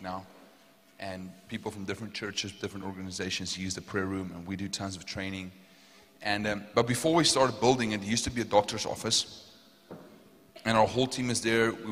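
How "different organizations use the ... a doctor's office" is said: ordinary delivery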